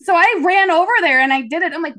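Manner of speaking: ordinary talk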